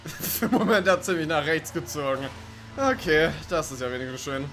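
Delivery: normal talking voice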